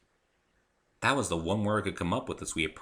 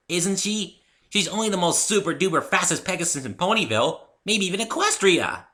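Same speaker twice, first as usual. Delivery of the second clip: much higher, bubbly pony gush; Pinky voice; 11 semitones higher, 7 dB louder than usual. A narrator's reading. Pinky gushes to the narrator about Rainbow Dash, high and bubbly. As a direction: gush with wide-eyed glee.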